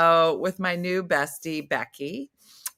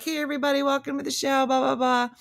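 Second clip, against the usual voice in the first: higher, lively emcee greeting, lightly imitated; open mic voice; 8 semitones higher, faster than usual. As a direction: greet the crowd like a stage host. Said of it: "your open mic voice where you're, like, hosting things on stage".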